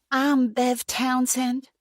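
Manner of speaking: high, slow Texas pageant lady; slow and high, syrupy Texas charm. A narrator's reading. Pageant woman introduces herself.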